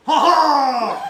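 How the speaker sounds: loud, high triumphant "ha ha"; hammed-up heroic cry; swashbuckler's laugh. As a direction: announce yourself with a big theatrical laugh. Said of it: "He goes".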